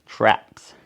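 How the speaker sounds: ordinary delivery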